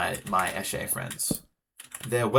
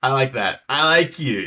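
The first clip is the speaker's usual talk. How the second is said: mock villain voice; 4 semitones higher, 10 dB louder than usual. Mock villain approval, loud.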